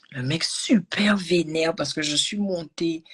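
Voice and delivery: confidence chuchotée, lente; chuchoté. Real, not acted. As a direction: raconter lentement, tout bas. Raconte l'arrivée, à voix basse. (confidence lente et chuchotée)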